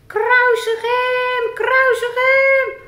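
high, slow, loud mock crowd yell; crowd shout. Puppet Rick acting out the crowd's loud angry shout. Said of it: "Wat riepen ze dan, Rick?".

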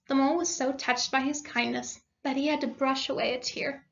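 ordinary delivery, nothing marked

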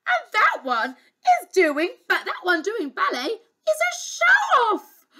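high, loud princess grumble; high-pitched, scornful child's complaint; indignant cry. Acted. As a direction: complain in spoilt outrage. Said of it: "she cried".

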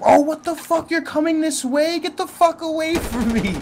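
high panicked yelling; panicking gamer. Real, not acted. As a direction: freak out as the cop closes in. Cop car coming at him.

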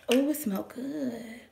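slow breathy whisper. Real, not acted. Vlogger smells the opened tea, slow whisper. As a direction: savor it in a whisper.

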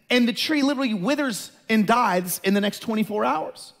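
ordinary talk

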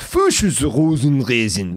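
loud, drawn-out gibberish German; mock German. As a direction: parody an impossibly long German street name. Mocking unspellable German street name.

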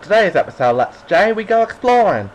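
high, loud mock Australian greeting; terrible Australian accent. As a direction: ham up a bad Aussie accent. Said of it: "that was a terrible Australian accent".

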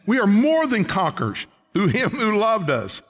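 preacher's triumphant cry; full-force preaching. Acted. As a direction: proclaim triumph.

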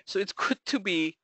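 ordinary delivery

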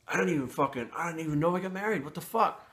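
rising, stunned panic at waking up married; shocked disbelief. Retold or imitated. Man retelling how he found out he was married, in shocked disbelief.

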